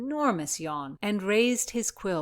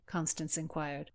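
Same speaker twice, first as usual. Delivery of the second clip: plain reading voice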